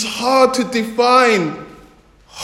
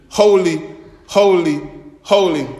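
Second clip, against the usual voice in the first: lower solemn repetition; 5 semitones lower than usual. Shown as a demonstration. Preacher explaining repetition to congregation, low reverent intoning. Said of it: "simple way to understand this, particularly in terms of the repetition".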